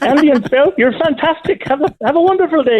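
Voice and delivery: very high Irish-Scottish sign-off; put-on Celtic accent. Caller signing off to the hosts, high mock Celtic accent. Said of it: "I just turned a bit Scottish".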